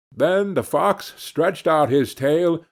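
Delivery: usual speaking voice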